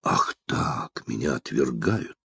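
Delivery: hushed, low theatrical despair; hammed-up wounded rejection, very soft; false despair. A narrator's reading. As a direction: sink to a tragic near-whisper. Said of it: "С фальшивым отчаянием возопил молодой человек".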